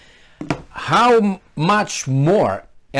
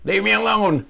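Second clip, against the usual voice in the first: higher-pitched put-on protest; mock helpless protest; 6 semitones higher than usual. What you'd tell the intruder, mock helpless protest. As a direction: plead to be left be, pitch raised.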